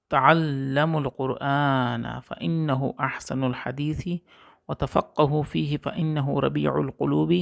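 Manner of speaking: slow, measured Arabic recitation of a saying. Acted. Teacher reciting Imam Ali's saying to students, slow and solemn. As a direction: recite each phrase slowly with solemn care.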